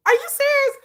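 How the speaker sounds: high, disbelieving squeak of shock; a startled double take; shocked what. Retold or imitated. Viewer reacting to a no-Filipinos sign, shocked.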